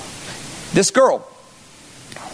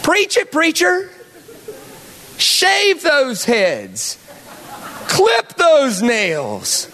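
hammed-up Southern preacher working up the crowd; Southern preacher accent; 6 semitones higher, slower than usual. Pastor mocking the verses as gospel, Southern preacher accent. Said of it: "in a Southern accent".

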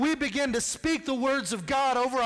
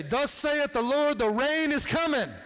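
mock prophet; 5 semitones higher than usual. Preacher mocks coworkers being told the rain is coming, in hammed-up prophet voice. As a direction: intone it like an old-time prophet, tongue in cheek. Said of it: "go into work tomorrow morning and say".

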